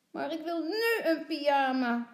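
put-on high, loud childish demand; whining little girl. A narrator's reading. Lotje wants pyjamas right away, whining. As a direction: insist like an impatient small child.

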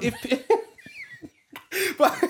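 laughing through words; breaking into laughter. Real, not acted. Laughing mid-sentence.

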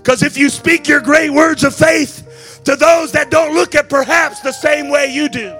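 slow, high, rising sermon build; preaching at full force. Acted. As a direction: lean into each word as the music swells.

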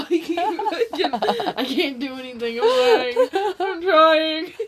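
high, slow, laughing near tears. Real, not acted. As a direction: laugh till it cracks into crying. Laughing till crying.

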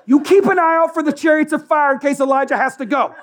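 high, loud, laughing command; mock-frantic Peter. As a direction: bark it out while cracking up. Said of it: "Peter's, like".

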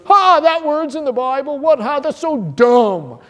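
high, scoffing voice, slow at the end; mock sneering skeptic. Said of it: "he goes".

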